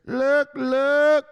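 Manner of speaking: very high, loud mock squawk; Larry Fink. Mocking Larry Fink as the market turns, loud squawk. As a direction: shriek it out in cartoon excitement. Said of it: "Not when Larry Fink is".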